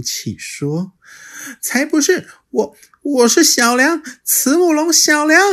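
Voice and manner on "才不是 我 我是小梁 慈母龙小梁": high, louder, quick denial; childlike protest. Acted. Little dinosaur denying to the T-rex, high and indignant. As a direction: stamp your foot like a small child.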